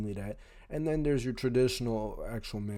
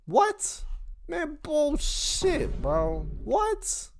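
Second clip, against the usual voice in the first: 1 mock disbelief; much slower than usual. Reacting to imagined critics.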